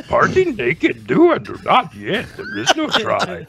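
slow, inverted Yoda impression; slow mock Yoda at a YouTube meeting. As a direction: play it like a wise little Jedi master. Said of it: "You walk into a YouTube meeting like".

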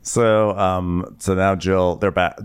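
ordinary delivery

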